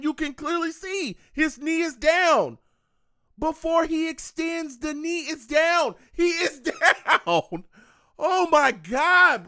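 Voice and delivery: high, loud, slowing into an outraged yell; real fan outrage, words dragged out and laughing at the end; furious shout. Real, not acted. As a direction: bellow at the refs in disbelief. Fan raging at ref call.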